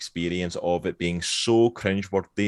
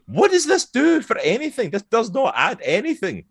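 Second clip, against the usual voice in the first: high-pitched incredulous rant; exasperated; 12 semitones higher, 3 dB louder than usual. Acted. Ranting at a pointless deleted scene, high exasperation. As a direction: push it high in baffled protest.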